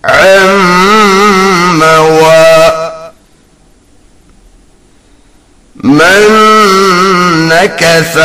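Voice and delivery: measured tajweed chant with long held nasals and vowels; Quran recitation. Shown as a demonstration. Teacher modelling Quran words for students, measured recitation. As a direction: recite each phrase steadily, drawing out the elongations.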